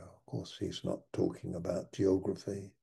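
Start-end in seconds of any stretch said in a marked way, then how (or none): none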